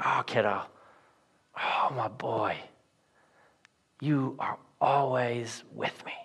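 put-on cradle the words like comforting a child; tender father. Father soothing his furious son, very soft and tender. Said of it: "He's like".

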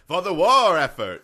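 loud, high, slowed wartime rallying cry; mock old-timey announcer. Co-host topping the dire warning, mock old-timey voice. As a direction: boom it like a hammed-up war newsreel.

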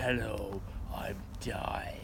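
whispery low croak; gravelly voice. Shown as a demonstration. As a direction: rasp it out like you're dying. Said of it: "manly, gravelly voice".

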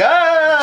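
high-pitched comic scream; mock scream. Imagining tripping clubgoers seeing the attack, mock scream. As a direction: scream like someone freaking out on mushrooms.

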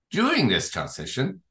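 ordinary delivery

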